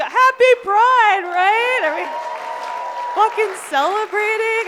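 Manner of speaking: loud, high hype shout; loud cheering call to the audience; rallying crowd. Real, not acted. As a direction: pump up the crowd.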